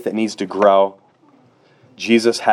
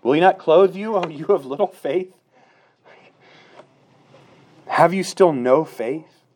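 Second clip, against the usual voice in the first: Jesus rebuking; 7 semitones higher than usual. Pretend. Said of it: "passage that Gail read".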